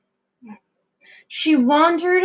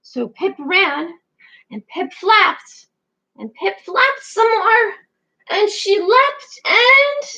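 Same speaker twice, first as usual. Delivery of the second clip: rising higher and higher to the leap; building excitement; 9 semitones higher, 5 dB louder, slower than usual. A narrator's reading. Narrator building suspense as Pip tries to fly.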